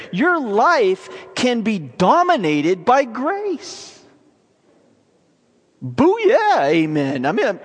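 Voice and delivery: high-pitched, amazed preaching with stretched key words; fervent preaching. Real, not acted. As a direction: preach it in rising disbelief and wonder.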